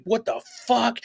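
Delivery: high-pitched mock outburst from dad; put-on high, incredulous dad; startled dad. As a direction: imitate a father jolted by a crash. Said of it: "my dad was like".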